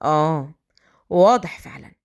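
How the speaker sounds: low, knowing male voice; sly, disbelieving agreement; Adham, sly. Acted. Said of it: "أدهم بمكر".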